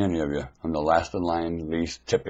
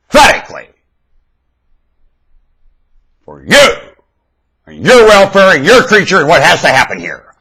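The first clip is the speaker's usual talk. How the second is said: fiery preaching; 12 semitones higher, 17 dB louder than usual. Real, not acted. Preacher demanding answers from congregation, fiery preaching. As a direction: drive each question home to the crowd.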